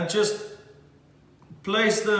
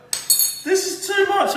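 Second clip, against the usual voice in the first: much higher, slow, hammed-up swoon of emotion; overcome with emotion; 12 semitones higher, much slower than usual. Chef swooning over his ossobuco, hammed-up emotion. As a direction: melt over the dish like it's too beautiful to bear. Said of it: "I'm getting so emotional".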